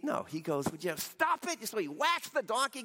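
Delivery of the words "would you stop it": higher-pitched mock scolding; exasperated parent. Stressed parent to the kids on a road trip, mock exasperation. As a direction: snap like a fed-up parent, without full yelling. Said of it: "I'm sorry I yelled at you".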